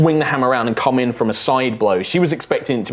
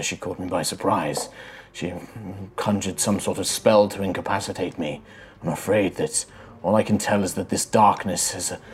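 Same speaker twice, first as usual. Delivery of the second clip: soft, lowered voice of a spirit; faint spirit voice; 3 semitones lower, 5 dB quieter than usual. Acted. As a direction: speak faintly from inside someone's head. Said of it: "this kind of faint voice like".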